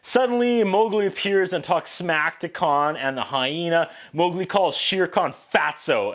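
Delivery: normal talking voice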